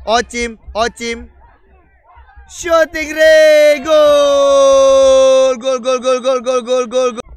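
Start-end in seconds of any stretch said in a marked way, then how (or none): 2.5-7.2 loud, slow rising goal shout, then rapid gol chant; goal call. Real, not acted. Commentator calls a goal. (teriakan gol)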